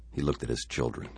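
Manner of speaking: ordinary delivery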